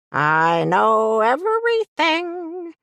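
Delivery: high, slow sing-song tease; sing-song taunt. Acted. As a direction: drawl it out like a teasing tune. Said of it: "taunted me in a sing-song voice".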